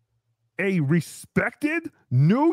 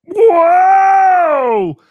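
much higher, very loud excited whoa; loud higher cry of delight; big whoa; 8 semitones higher, 13 dB louder than usual. Acted. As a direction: belt out a host's whoa. Excited show opening cry.

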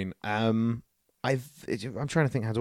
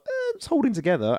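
grudging approval; 11 semitones higher than usual. Retold or imitated. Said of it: "I was like".

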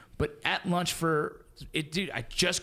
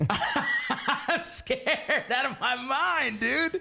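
laughing; 10 semitones higher, much slower than usual. Real, not acted. Laughing about being scared.

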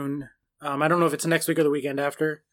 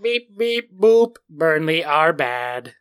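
toy robot voice; 5 dB louder, much slower than usual. Pretend. Robotic stats voice.

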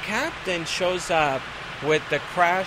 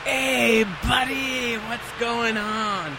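put-on higher, slurred drunk passenger voice, slowed down; drunk guy voice; 6 semitones higher, much slower than usual. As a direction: mimic a friendly drunk leaning over.

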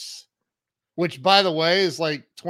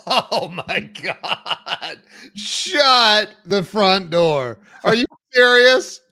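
loud amazed outburst, laughing into it; stunned disbelief; 5 dB louder, slower than usual. Real, not acted. Host hearing Zig Ziglar showed up, shocked loud. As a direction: gasp then shout your disbelief.

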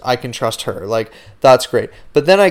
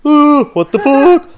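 much higher, louder, slow shocked squeal; imitating Michaela; 8 dB louder, slower than usual. Mimicking Michaela in the car watching the sparks fly. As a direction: shriek it in slow disbelief. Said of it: "Michaela's in the car like".

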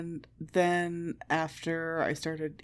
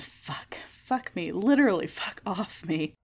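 ordinary talk

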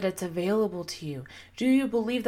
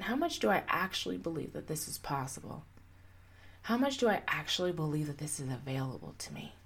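much softer, lower, turned inward; quiet inner question; 6 semitones lower, 9 dB quieter than usual. Shown as a demonstration. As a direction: ask yourself under your breath. Voices the inner check-in question.

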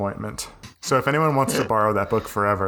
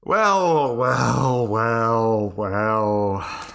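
stalling wells; much slower than usual. Real, not acted. Stalling with repeated well.

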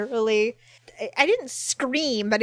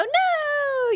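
a high, drawn-out mock "No"; group denial. League members hiding their draft number, high coy denial. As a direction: everyone coyly denying it. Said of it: "Everybody will go".

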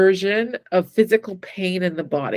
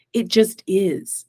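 slow, weighty "It just is."; slow and settled; slower than usual. Shown as a demonstration. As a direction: let "It just is." land slowly, each word apart. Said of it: "a place where it's right or wrong".

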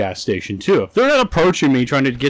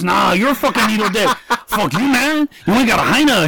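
mock gangster; 7 semitones higher than usual. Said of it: "He goes".

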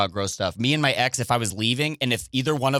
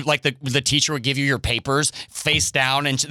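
normal talking voice

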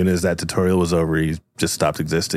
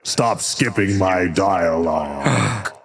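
annoyed slow villain; much slower than usual. Acted.

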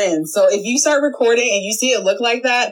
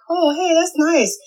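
mock casual aside; 8 semitones higher, slower than usual. Acting out looking off-camera.

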